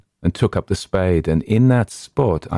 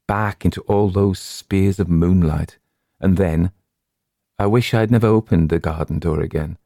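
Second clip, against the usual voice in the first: ordinary delivery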